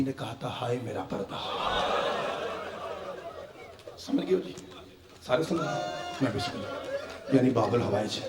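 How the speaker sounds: soft, hushed lament voice; mournful masaib recitation. Acted. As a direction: draw them gently into grief. Masaib recitation after fazail.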